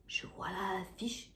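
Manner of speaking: hushed whisper. Acted. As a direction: drop to a whisper. Whispered discovery in story. (chuchoté)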